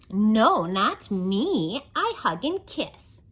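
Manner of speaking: lively singsong rhyme reading; reading to children. A narrator's reading. Storyteller reading a rhyming book to kids, lively. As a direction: read the rhymes to the kids with bounce.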